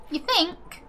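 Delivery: sarcastic. Real, not acted.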